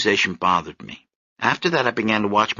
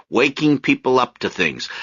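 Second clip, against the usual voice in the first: plain reading voice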